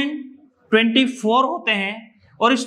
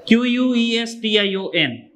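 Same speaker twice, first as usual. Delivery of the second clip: ordinary talk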